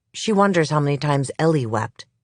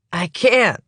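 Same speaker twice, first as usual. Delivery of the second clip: frightened suspect; 7 semitones higher, 3 dB louder than usual. Acted. Suspect panicking, about to vomit.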